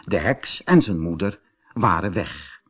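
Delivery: ordinary delivery, nothing marked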